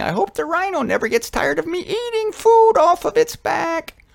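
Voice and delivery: high-pitched hopeful bird voice; squeaky-high excited tick bird. Acted. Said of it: "the tick bird at the same time is saying".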